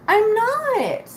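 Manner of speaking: high, drawn-out exasperated insistence; emphatic protest. Real, not acted. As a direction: wail the refusal, pitch soaring then dropping. Emphatic protest of disliking phone calls.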